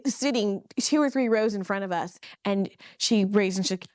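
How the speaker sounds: ordinary talk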